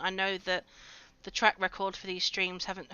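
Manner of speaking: normal talking voice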